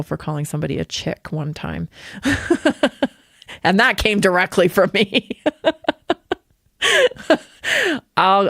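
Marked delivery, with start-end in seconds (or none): 1.9-3.1 high burst of laughter; amused laugh. Real, not acted. Parent laughs at son getting in trouble, amused laugh. 5.5-6.3 high, louder-running giggle; amused laugh. Real, not acted. Parent owns up to teaching the word, laughing. Laughs at admitting she taught him.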